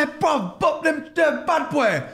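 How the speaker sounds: high, slow, hammed-up London slang to Italians; his London accent. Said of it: "'cause my accent".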